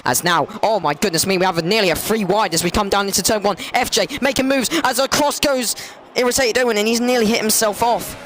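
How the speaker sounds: rising alarm at a near crash; excited commentary. Real, not acted. Commentator calling carnage into turn one, high and excited. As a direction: pitch jumps up as chaos unfolds.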